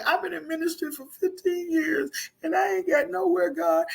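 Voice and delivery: a hint of a whining minister; complaining minister. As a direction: lightly whine about getting nowhere.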